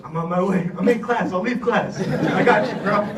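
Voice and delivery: loud, high, eager "I got you"; a keen pal shouting he's on his way; eager friend. Retold or imitated. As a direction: burst in like a buddy dropping everything to help. Friend answering a call for help.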